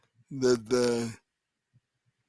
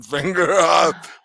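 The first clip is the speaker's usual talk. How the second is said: ordinary delivery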